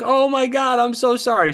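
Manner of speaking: high, loud, flustered apology; high apologetic voice. Retold or imitated. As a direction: squeak out a flustered sorry. Michelle apologizing in retold story.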